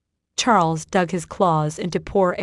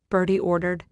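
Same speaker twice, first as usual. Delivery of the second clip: ordinary delivery, nothing marked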